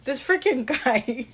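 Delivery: ordinary talk, nothing marked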